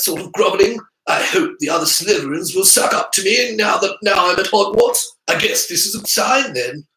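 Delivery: put-on high, preening boy voice; pleased Draco. A narrator's reading. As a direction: bask in the flattery. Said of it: "Draco voiced in story".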